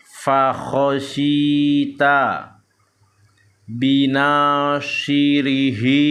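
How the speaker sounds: slow, drawn-out Arabic syllables on an even tone; measured Quran-reading demonstration; Iqra recitation drill. Shown as a demonstration. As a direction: chant each syllable long and steady. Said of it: "ini dipanjangkan bibirnya karena ini huruf short".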